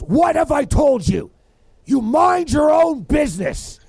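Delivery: imitating a furious father, high and loud; retold angry yell. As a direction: scold like an angry dad, stretching the words. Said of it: "he's yelling".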